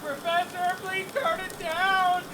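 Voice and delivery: high, slow, pained pleading; pained plea. Pretend. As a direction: beg through the burning pain. Being burned, pained plea.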